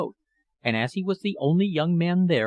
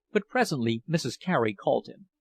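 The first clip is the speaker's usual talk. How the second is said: usual speaking voice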